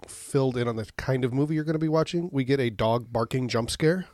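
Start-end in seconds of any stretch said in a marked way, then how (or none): none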